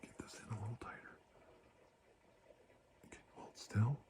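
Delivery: soft unvoiced whisper. Doctor tightening the measuring tool on the face, whisper. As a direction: hush it close to the mic.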